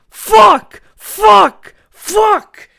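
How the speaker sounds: high, loud bursts of cursing; pained yelling. Retold or imitated. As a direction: yell each word as a separate jolt of pain. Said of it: "we just keep hearing".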